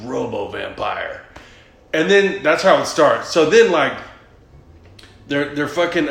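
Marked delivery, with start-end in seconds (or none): 0.0-1.2 low, slow announcer voice for the title; movie title voice. Retold or imitated. Retelling the movie's title card in a deep trailer voice. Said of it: "and then it goes".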